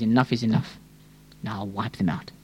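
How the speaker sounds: low, hushed finality; grave divine verdict. Acted. Preacher voicing God finally giving up on the people, grave and hushed. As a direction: pronounce the sentence softly and low.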